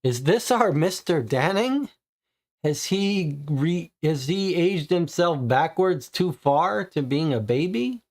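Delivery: rising, incredulous questions; surprised. Real, not acted. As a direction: realize the twist in disbelief. Player realizes baby twist.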